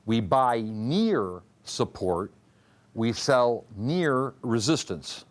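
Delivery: near drawn out and weighted, slow; slow, deliberate rule with near stressed. Shown as a demonstration. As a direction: press the word near each time. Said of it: "I emphasized the word near".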